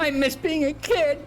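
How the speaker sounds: mock-whiny adult missing childhood; wistful adult lament. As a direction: ham up the longing for childhood. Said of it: "we're like".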